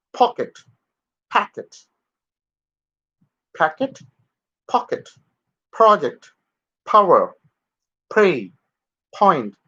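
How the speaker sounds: "pocket" "packet" "power" with a strong puff on p; aspirated English p. Shown as a demonstration. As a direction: push air out on the p. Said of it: "a kind of additional force in English p".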